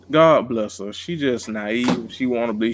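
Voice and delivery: ordinary talk, nothing marked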